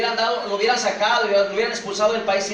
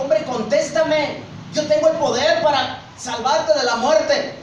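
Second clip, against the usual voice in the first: insistent demand; 8 semitones higher, 5 dB louder, slower than usual. Acted. Pilate demands answer from silent Jesus.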